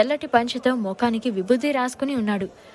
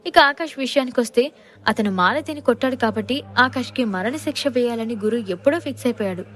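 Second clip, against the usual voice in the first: usual speaking voice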